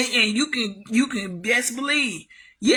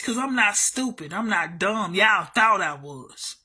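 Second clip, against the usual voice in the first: normal talking voice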